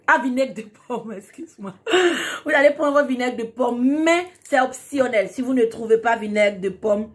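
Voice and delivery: normal talking voice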